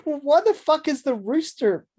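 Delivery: high-pitched baffled outburst; incredulous. Real, not acted. Co-host baffled by the rooster hook, incredulous high outburst. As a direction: squawk it in exasperated disbelief. Exasperated rant about senseless hook.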